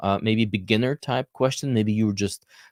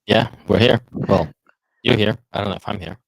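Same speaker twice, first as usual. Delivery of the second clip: crackly voice; 6 dB louder than usual. Real, not acted. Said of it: "you sound very crackly".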